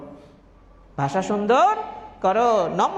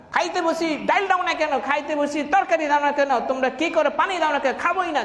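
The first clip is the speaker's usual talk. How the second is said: put-on much higher, petulant voice of a spoiled kid refusing food; stubborn whining child; 8 semitones higher than usual. Preacher mimicking a sulky child complaining about dinner, whiny and stubborn. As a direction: whine like a child throwing a tantrum at the table. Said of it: "জিদ করে উঠা যায়".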